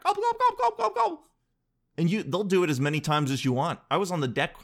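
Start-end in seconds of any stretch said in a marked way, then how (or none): 0.0-1.3 very high mimicked gobbling; high-pitched turkey impersonation; imitated turkey reply. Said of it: "they literally make that sound back".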